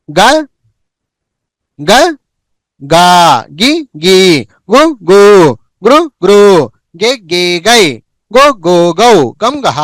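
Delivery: short vowel then stretched vowel, from గ to గహ; syllable chart recitation. Shown as a demonstration. Teacher showing how to recite గా గుణింతములు, measured chanting. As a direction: recite గ, గా in short-long pairs. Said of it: "పలక విధానం ఏంటి?".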